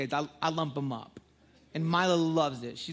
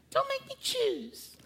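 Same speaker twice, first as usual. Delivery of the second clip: put-on much higher and slow, pleading child; whiny little girl; slower than usual. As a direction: drag it out like a torn toddler. Daughter's reply voiced.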